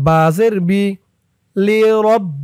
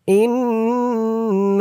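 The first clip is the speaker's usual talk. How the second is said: slow melodic tajweed chant; Quran recitation; 4 semitones higher, much slower than usual. Shown as a demonstration. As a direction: draw out every vowel in recitation. Recites word slowly.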